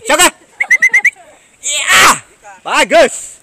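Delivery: high, loud praise called out; calling out. Real, not acted. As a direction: cheer the bird home loudly.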